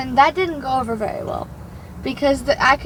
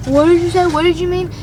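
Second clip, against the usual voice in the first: higher, louder, insistent questioning; retold bewildered challenge, voice raised; confused demand; 5 semitones higher, 6 dB louder than usual. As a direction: imitate a flustered coworker demanding clarification. Retold coworker confused, demanding repeat.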